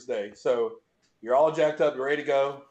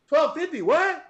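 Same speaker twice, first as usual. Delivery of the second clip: high incredulous echo; acted-out astonished question; retold disbelief; 12 semitones higher than usual. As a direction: repeat it back way up high, baffled.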